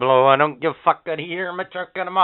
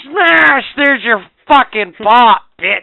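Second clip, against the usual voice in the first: loud, high mock battle yell; hammed-up aggressive driver yell; gleeful attack shout; 7 semitones higher, 6 dB louder, slower than usual. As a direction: act out hurling it, shouting. Acts driver hurling pop through window.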